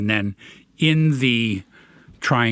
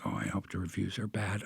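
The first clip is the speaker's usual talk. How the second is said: low, much softer murmur; retold private thought, dropped low and soft; secret envious aside; 6 semitones lower, 15 dB quieter than usual. Host confessing envy of friends' films, low guilty aside.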